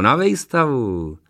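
„vejstavu“ stretched slowly, rising high then falling; drawn-out repeat. A narrator's reading. Workman explaining to granny, slow drawn-out „vejstavu“. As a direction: spell it out slowly, dragging „vejstavu“.

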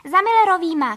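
loud, high call back; shouted reply. A narrator's reading. Narrator running to the lake shouts back, calling out. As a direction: yell the answer over your shoulder. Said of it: "křikla jsem na něj v odpověď".

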